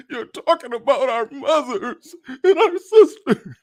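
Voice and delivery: imitating a crying man, high, loud, voice cracking; mock crying voice. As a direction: ham up a tearful, cracking plea between laughs. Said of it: "a little voice crack".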